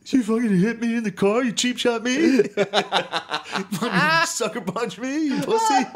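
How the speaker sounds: high, laughing mock of a whiny teen yelling at dad. Kid mocking himself shouting at his dad, high and laughing. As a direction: squeal it like an outraged kid, laughing.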